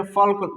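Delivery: फॉल्क with L heard before K; wrong sounded L. Shown as a demonstration. Teacher quoting wrong readers, फॉल्क with L. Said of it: "जो कि गलत है".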